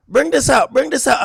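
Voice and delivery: high, loud bossy mom voice; mimicking mom. Streamer imitating his mom ordering him around, high and loud. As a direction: bark orders like a fussy mother. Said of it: "So my mom's like".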